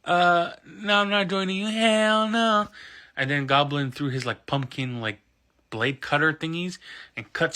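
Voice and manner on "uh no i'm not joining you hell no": high, loud mock refusal; Spider-Man. Hero refusing to join the villain, high-pitched and emphatic. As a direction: climb higher into an indignant no. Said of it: "Spider-Man's like".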